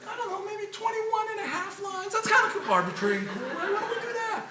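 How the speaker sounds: lightly voiced careless committee, soft and airy; mock a lazy arbitrary decision; a hint of a dopey committee member shrugging it off; mock casual idiot. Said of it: "nobody sat there and said".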